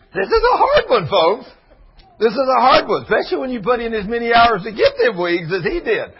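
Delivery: high and loud through laughter; high, loud laughing speech. Real, not acted. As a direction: say it still laughing. Laughing while speaking.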